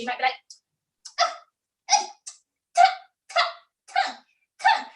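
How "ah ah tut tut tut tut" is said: short, separate nasal tuts; nasal tutting. Shown as a demonstration. Teacher demonstrates nose resonance, nasal tutting. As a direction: tut through the nose, one at a time. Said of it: "tutting from your nasal quality".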